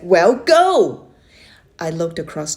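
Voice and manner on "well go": loud impatient command, pitched up; raised voice. Acted. Uncle yells at the hesitating boy to swim, raised voice. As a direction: bark it out to get him moving. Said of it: "He raised his voice and said".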